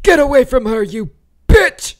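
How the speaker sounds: raspy, high-pitched furious yell; Ripley. Acted. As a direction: scream it with a rasp in your throat. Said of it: "I like a really raspy Ripley".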